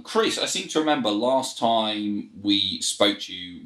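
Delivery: ordinary delivery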